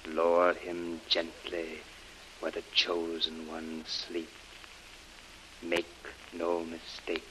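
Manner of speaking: ordinary talk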